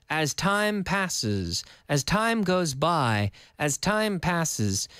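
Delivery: slow, drawn-out teacher phrasing; slow model translation. Shown as a demonstration. Teacher giving English translations of the phrase, slow and measured. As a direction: stretch each phrase for learners.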